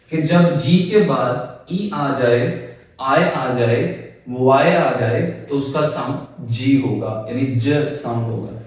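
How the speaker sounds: ordinary delivery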